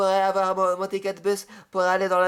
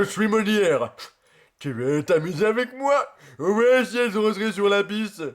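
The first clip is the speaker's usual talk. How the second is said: slow theatrical put-on voice; lightly voiced stage actor; Molière impression; slower than usual. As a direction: announce yourself grandly as Molière. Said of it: "c'est Jim Carrey".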